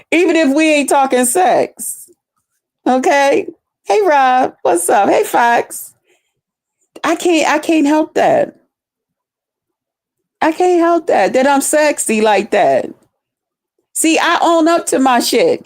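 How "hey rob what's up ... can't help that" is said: usual speaking voice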